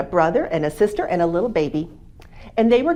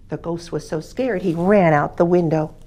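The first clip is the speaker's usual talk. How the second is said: ordinary delivery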